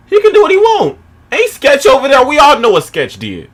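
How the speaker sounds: high, loud, heated outburst; indignant shouting. Real, not acted. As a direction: shout it out in exasperated defence. Indignant outburst defending the man.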